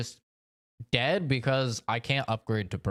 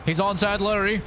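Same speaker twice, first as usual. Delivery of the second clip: raging gamer; 5 semitones higher than usual. Real, not acted. Frustrated fight, yelling.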